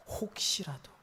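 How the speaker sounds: a hushed, trembling whisper of a hope. Acted. Hezekiah whispering his timid hope to God. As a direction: barely breathe the words out, unvoiced. Hezekiah's prayer, first hesitant take.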